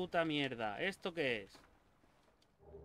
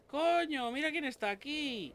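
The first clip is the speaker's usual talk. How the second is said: surprised outburst; 10 semitones higher, 3 dB louder than usual. Real, not acted. Surprised greeting of game character.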